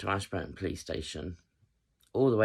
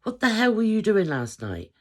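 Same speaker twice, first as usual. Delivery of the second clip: much higher and louder, demanding; dad demanding to know what happened last night; imitating furious dad; 7 dB louder than usual. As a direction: confront your daughter, exasperated. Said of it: "he's like".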